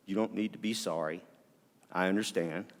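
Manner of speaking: a retold wife's soft comfort; gentle reassurance. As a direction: ease his guilt quietly, as she did. Wife reassures him, retold.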